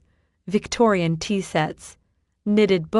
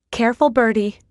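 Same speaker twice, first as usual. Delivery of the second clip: louder, higher warning; 4 semitones higher, 5 dB louder than usual. A narrator's reading. Charlene warning Bertie before patrons, warning. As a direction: caution her sharply. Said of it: "Charlene warned".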